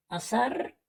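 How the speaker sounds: azar said with an s, as in Latin America. Shown as a demonstration. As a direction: say azar as "asar", z like s. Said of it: "pronunciamos la Z igual que la S". (azar con seseo latinoamericano)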